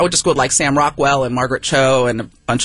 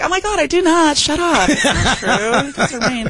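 put-on much higher, laughing valley-girl whine; mock valley girl; 9 semitones higher, slower than usual. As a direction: play her teenage self denying it. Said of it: "I'm like".

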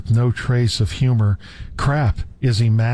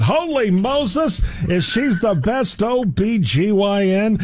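high, slow hammed-up gasp; overdramatic exclamation; 8 semitones higher than usual. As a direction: ham up the shock, drawn out. Melodramatic reading of exclamation.

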